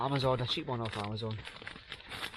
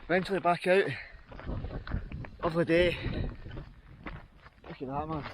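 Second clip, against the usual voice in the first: out of breath. Real, not acted. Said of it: "Sorry, I'm out of breath".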